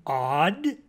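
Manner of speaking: affronted exclaimed question; indignant cry. A narrator's reading. Mockingbird offended at being called odd, crying out. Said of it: "cried the mockingbird".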